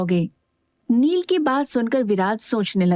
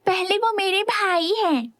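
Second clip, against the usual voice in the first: put-on much higher, childlike teasing voice; childishly; 9 semitones higher than usual. A narrator's reading. Sister squabbling with her son over her brother, childish. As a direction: say it like a squabbling kid. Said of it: "बच्चों की तरह बोली".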